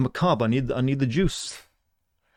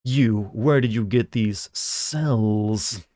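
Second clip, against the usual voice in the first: a hint of a cold, probing mad scientist; evil scientist; much slower than usual. Streamer voicing a scientist questioning a cop, slow mild menace. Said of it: "radiating big evil scientist energy".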